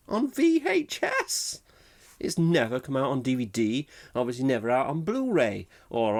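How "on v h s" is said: much higher, slow, laughing disbelief; incredulous. Real, not acted. Host can't believe it only came on VHS, laughing squeal. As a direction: stretch out VHS in amazement.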